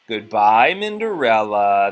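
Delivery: loud, drawn-out taunting goodbye; teasing. A narrator's reading. Nephews teasing Minderella as they leave, loud mock farewell. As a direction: stretch the name out to mock her. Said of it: "they teased".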